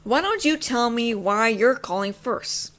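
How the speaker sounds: usual speaking voice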